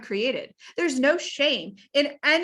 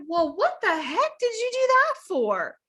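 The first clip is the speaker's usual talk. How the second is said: baffled man; 4 semitones higher, 4 dB louder than usual. Retold or imitated. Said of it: "And he's like".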